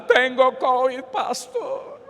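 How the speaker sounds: high, drawn-out mock wail; imitating a man falling apart in tears; hammed-up crying. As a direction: wail it slowly, breaking into exaggerated sobs. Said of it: "Yo lo dejé un ratico llorar".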